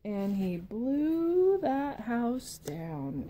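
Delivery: drawn out very slowly; slow dramatic blow. Acted. Storyteller drawing out the house falling, very slow. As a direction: stretch it out for the big moment. Climax of the blowing.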